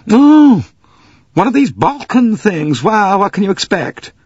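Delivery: high, loud wailing American lady. Acted. Missus Hubbard wailing to the passengers, high and loud.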